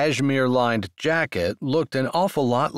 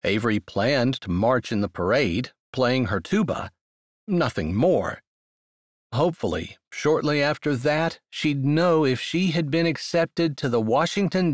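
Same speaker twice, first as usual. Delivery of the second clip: ordinary delivery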